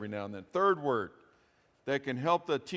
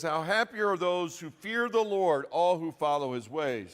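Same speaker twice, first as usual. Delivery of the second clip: raised, ringing scripture read; 3 semitones higher, 5 dB louder than usual. Acted. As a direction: proclaim the psalm to the congregation. Reads Psalm aloud.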